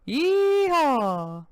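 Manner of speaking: loud high yeehaw; cowboy whoop. Acted. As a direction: let out a full cowboy whoop.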